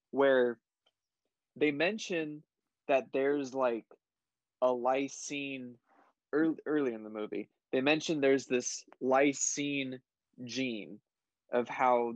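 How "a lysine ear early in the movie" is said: normal talking voice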